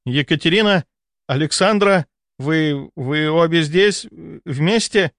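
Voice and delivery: stammering high-pitched shock; horrified baron. A narrator's reading. Baron sees both ladies at his bed, stammering in horror. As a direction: caught out, he can barely get the names out.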